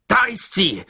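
high, loud mob-leader's bellow; roaring. A narrator's reading. Trout Walker leading the mob into the school, roaring. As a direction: roar it out as an accusation. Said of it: "brüllte Trout".

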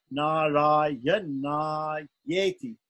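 2 "Narayana Yeti" very slow, the "Yeti" drawn out long; 3 hold the long e in "Yeti" so the student hears the length; 1 lengthened vowel demo. Shown as a demonstration. 4 teacher corrects a student by slowly modelling "Narayana Yeti" with a long "Yeti". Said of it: "Yeti has to be a little bit longer".

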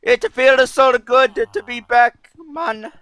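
high, loud, hammed-up Arabian accent; loud comic Arabian accent; attempted Arabian accent. Shown as a demonstration. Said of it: "let me try that again".

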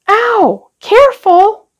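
put-on high girlish cry of alarm; startled cry. A narrator's reading. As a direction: yelp as if something just hurt her. Sister cries out burnt by dragon.

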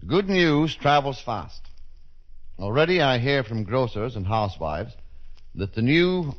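usual speaking voice

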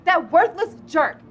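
high, loud indignation; outraged outburst. Acted. Woman offered a dollar for her engagement ring, outraged shout. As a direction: burst out in shocked fury.

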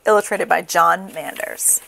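ordinary delivery

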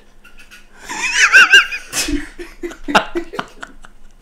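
a high, loud burst of laughter; shrill laughing fit; shrieking laugh. Real, not acted. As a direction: cackle wildly at your own joke. Laughs after own punchline.

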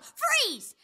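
high, retold cat's command; mild mock yell; imitated yell. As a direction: mimic a cat yelling an order. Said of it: "The cat yelled".

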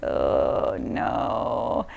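breathy whispered oh no; whispered dismay. Retold or imitated. Imagining reading her own audiobook, whispered dismay. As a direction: whisper it in mock horror. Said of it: "but I'm like".

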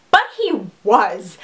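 usual speaking voice

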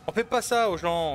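high, loud outraged protest; indignant shout. Real, not acted. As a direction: yell it at the game, outraged. Outrage after jump scare.

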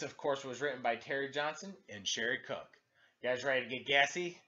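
plain reading voice

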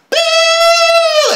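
very high, very loud piercing boo; loud imitated frequency. Describing graveyard ringing to friends, piercing high loud boo. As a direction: blast a shrill high boo like an alarm. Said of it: "this shit is dumb loud".

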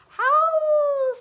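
very high, loud, sustained howl; storyteller's playful wolf howl; werewolf howl. A narrator's reading. Story werewolf father howls.

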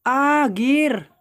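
high, loud, drawn-out realisation; surprised discovery. Real, not acted. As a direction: exclaim as you spot the find. Finds the item he was hunting. (masiglang pagkatuklas)

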